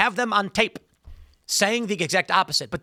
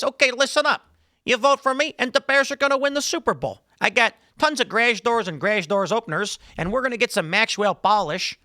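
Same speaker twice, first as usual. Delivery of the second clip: high, hammed-up Chicago accent; fake Chicago accent; 5 semitones higher than usual. Shown as a demonstration. Candidate courting Chicago voters, in a fake Chicago accent. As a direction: put on a cartoonish Chicago twang. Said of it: "I can do the fake Chicago".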